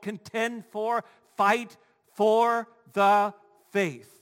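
slow, emphatic exhortation; insistent preacher, hammering the final words slowly; forceful preaching. Real, not acted.

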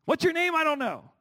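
squeaky sing-song parent scolding. Retold or imitated. Preacher imitating parents mid-argument, high mock exasperation. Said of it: "If you're a parent, you talk like that".

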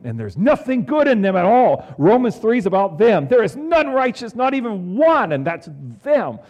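high, loud declaiming of scripture; fervent preaching. Acted. Preacher to congregation, quoting Romans high and loud. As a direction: lift the verse high and loud over the congregation.

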